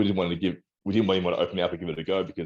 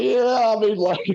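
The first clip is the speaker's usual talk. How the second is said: laughing; 7 dB louder, slower than usual. Retold or imitated. Laughing while retelling own reply.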